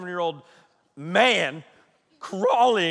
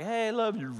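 soft mumbled sign-off, a little higher; slightly higher, softly fading sleepy imitation; mock drifting off; 4 semitones higher, 8 dB quieter than usual. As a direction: imitate someone dropping off to sleep mid-sentence. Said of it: "she's out".

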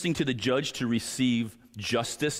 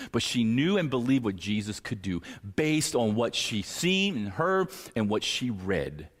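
ordinary talk, nothing marked

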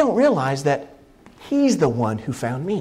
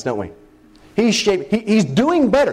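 usual speaking voice

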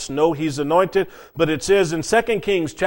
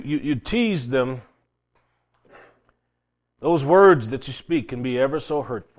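usual speaking voice

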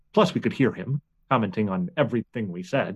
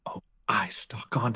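soft unvoiced whisper; whispered aside; 3 semitones lower, 8 dB quieter than usual. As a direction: whisper it so nobody hears. Aside while hiding.

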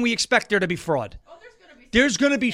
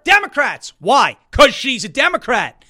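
loud emphatic; 4 semitones higher, 8 dB louder, slower than usual. Real, not acted.

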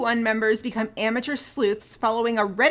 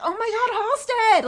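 acted delighted recognition, much higher; excited reunion greeting; 11 semitones higher than usual. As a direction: answer a long-lost buddy, stretched and high. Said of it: "And he's like".

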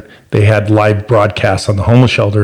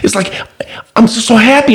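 put-on high, small voice of a thrilled boy; high-pitched, bursting with happiness; excited young son; 10 semitones higher than usual. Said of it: "I'm so excited".

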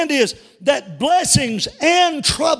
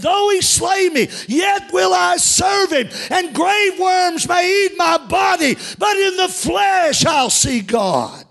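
preached shout; 7 semitones higher than usual. Acted. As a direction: cry Job's vow out to the whole church. Job's words preached at full force.

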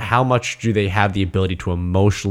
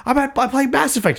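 blurted much higher, in mock distress; panicked explanation. Telling roommate Chet where he is in the game, high mock panic. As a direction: squeak it out like you're in trouble. Panicked self quoted on phone.

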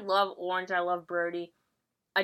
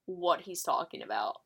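ordinary talk

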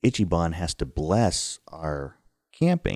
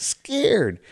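tag. Retold or imitated. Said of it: "a little scared Weebelows now".

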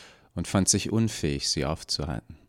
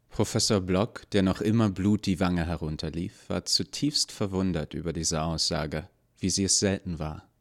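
plain reading voice

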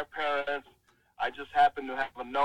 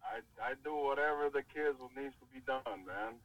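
sexy voice; 8 dB quieter than usual. Real, not acted. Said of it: "Stop trying to give us your sexy voice".

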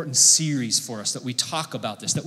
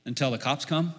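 softer, calm employee voice; calm request; 3 semitones lower, 6 dB quieter than usual. Retold or imitated. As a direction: settle them down gently. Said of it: "the worker there from Starbucks kinda talks to them".

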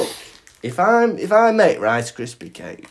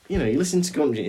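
ordinary talk

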